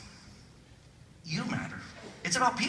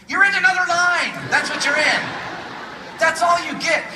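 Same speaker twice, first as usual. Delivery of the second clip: high, loud exasperated yell; hammed-up indignant shouting; mock outrage; 9 semitones higher, 8 dB louder than usual. Park visitor finds another line after waiting, mock-outraged yell.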